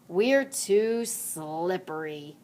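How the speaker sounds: drawn out slowly, sly; slow playful tease. Acted. Henry joking to Mudge, slow and sly. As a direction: stretch it out with a grin. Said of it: "Henry said".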